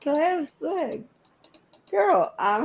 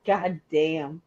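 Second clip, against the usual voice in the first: usual speaking voice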